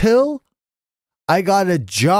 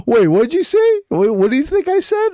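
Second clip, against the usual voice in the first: startled; 11 semitones higher, 3 dB louder than usual. Retold or imitated. Shocked dad questions kid.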